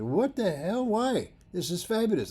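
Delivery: high, indignant protest; mock outraged student; lightly voiced upset student. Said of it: "And he said".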